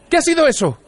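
high-pitched, alarmed cry; startled yell. A narrator's reading. Arthur jumping up at a crash outside, startled yell. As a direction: yelp the question in a high voice. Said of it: "gritó".

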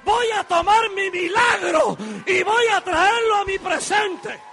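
high, loud, shouted preaching over music; fiery revival preacher crying out to the church; full-force preaching. Acted. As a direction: preach it to the rafters, building.

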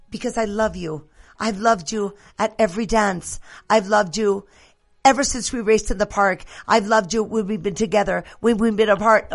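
mock period-drama confession of love in English; Bridgerton lover. Host parodies a Bridgerton proposal to show romance, theatrically ardent. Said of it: "ahorita voy a entrar, Bridgerton".

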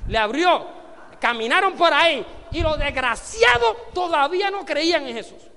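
loud indignant preaching; rising to a furious shout at the crowd; shouting at the disbelief of the crowd; outraged shout. Real, not acted.

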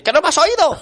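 high, loud exasperated telling-off; mock angry scolding. Friend imitating a clerk scolding the queue, loud and high. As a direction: scold him like an impatient clerk.

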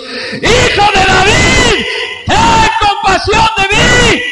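very high, very loud, slow desperate cry. Acted. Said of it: "se puso a gritar aún más fuerte". (grito desesperado, aún más fuerte y agudo)

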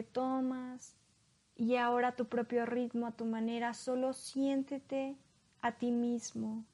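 calm hypnosis guide, long pauses. Acted. Leads a hypnosis induction. (voz lenta de hipnotizador)

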